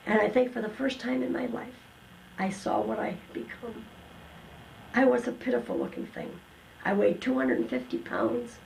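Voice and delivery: ordinary talk, nothing marked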